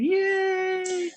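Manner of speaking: high squealed yay; excited cheer. Real, not acted. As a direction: cheer the crowd on. Cheering backstage audience on camera.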